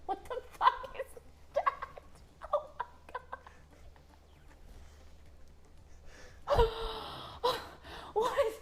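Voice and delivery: high squealing shock; shocked laughing. Real, not acted. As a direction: squeal in disbelief through laughter. Shocked at gross sight.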